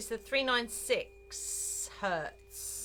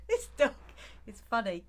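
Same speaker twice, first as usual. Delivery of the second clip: ordinary talk, nothing marked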